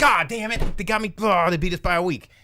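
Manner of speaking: high, loud mock outburst of defeat; mock frustration. Podcaster voicing studios beaten to release, mock-frustrated yell. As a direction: curse your luck like a beaten rival, hammed up.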